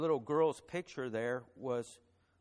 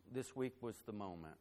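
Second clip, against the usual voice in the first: softer, holding back tears as the story lands; fighting back emotion; 3 semitones lower, 5 dB quieter than usual. Real, not acted. Pastor tells the church of friends' lost baby, softly choked up. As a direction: keep it hushed, voice close to breaking. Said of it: "gonna try to tell this story without getting emotional".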